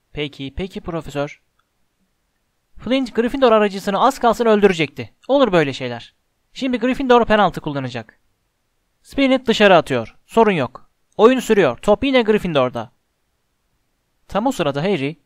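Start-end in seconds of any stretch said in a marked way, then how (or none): 0.1-1.4 soft, backing-down reply; grudging compliance. A narrator's reading. Commentator warned by the professor, subdued compliance. 2.8-12.9 lively, pitched-up play-by-play; resume calling the match with pointed energy; sports commentary. A narrator's reading. Lee Jordan resuming commentary after a warning, brisk match call.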